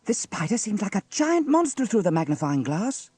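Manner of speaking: put-on high, loud child's amazement; amazed cry. A narrator's reading. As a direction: exclaim wide-eyed, high and loud. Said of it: "cried Sarah".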